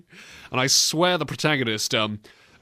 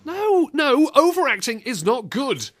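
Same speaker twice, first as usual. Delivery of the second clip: indignant protest; 11 semitones higher than usual. Real, not acted. Heated argument over the acting.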